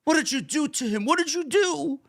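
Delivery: high-pitched imitation of a sobbing mother; mock sobbing voice. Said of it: "in this sobbing voice".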